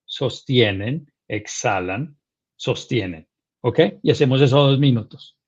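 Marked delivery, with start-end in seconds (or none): 0.1-3.3 slow, soft, lower breathing cues; guided breathing cue. Shown as a demonstration. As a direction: draw each cue out calmly. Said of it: "Yo les voy a contar".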